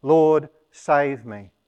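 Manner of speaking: loud, slow plea to be saved; crying out. Retold or imitated. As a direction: call out for rescue, drawn out. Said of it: "cried out".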